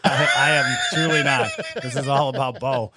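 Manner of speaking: laughing through words. Real, not acted. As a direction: say it while cracking up. Laughing while denying.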